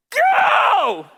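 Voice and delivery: one huge, high, drawn-out yell; too worked up for sentences, just bellow it; worked-up shout. Retold or imitated. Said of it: "I'm so worked up".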